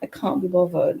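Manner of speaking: softly imitated British accent; put-on British accent, light and dismissive; friend's British accent. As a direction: mimic a British friend's catchphrase. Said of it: "this is how she says".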